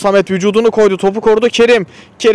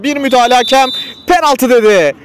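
excited commentator; 6 semitones higher than usual. Real, not acted. Penalty call in live commentary.

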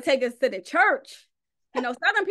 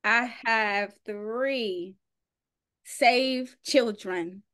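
very slow, deliberate, proud; slowly imitating her proud introduction; proud slow quote; much slower than usual. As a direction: savor each word like a proud mother. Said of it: "That's how I'm gonna start introducing".

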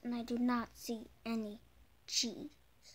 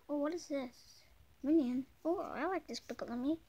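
usual speaking voice

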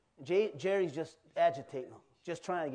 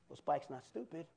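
ordinary talk, nothing marked

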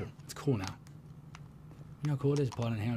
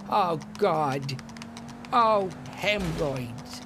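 high drawn-out groan of pain; Lester voice; 5 semitones higher, 4 dB louder, much slower than usual. Acted. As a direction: stretch the complaint slowly, pitched up. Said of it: "But I'll just reenact it".

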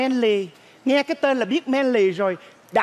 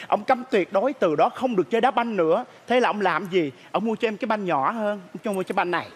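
ordinary talk, nothing marked

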